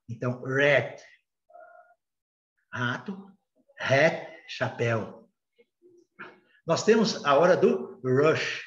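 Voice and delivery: ordinary talk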